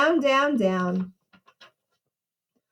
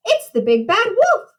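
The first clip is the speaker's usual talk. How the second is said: high-pitched storybook alarm; alarmed cry; 8 semitones higher, 3 dB louder than usual. A narrator's reading. Little pig warns that the wolf has come, alarmed cry. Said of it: "cried Horace".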